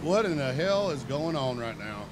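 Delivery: low, soft baffled aside; quiet stunned aside; low muttered disbelief. Retold or imitated. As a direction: mutter it under your breath in shock. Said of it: "All of us are just kinda like".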